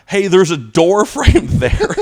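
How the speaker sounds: teacher mocking a student who hit the door frame; snarky warning. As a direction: taunt him like a prick. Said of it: "just to be a prick".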